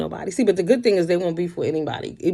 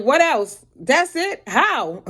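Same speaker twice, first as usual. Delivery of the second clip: disbelieving; 7 semitones higher, 3 dB louder, much slower than usual. Retold or imitated. Friend says only bleach spray, mock disbelief. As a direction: pitch jumps higher in disbelief. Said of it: "I'm like".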